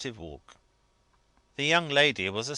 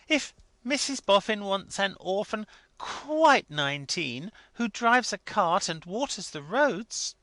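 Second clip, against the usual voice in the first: lightly voiced tactful wife; 11 semitones higher, 5 dB louder than usual. A narrator's reading. Wife hinting doubt to her husband, lightly high. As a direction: let the doubt show gently.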